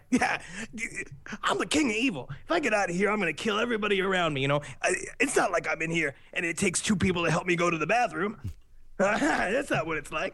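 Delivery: put-on cackling madman; mock king-of-evil rant ending in laughter; Charles Manson. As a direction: imitate a rambling, boastful prisoner. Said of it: "he's like".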